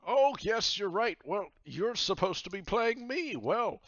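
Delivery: high, loud jovial pirate captain. Acted. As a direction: boom it out like a cheery pirate host. Captain Myers voice.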